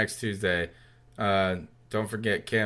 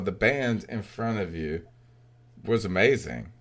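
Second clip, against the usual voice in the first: ordinary talk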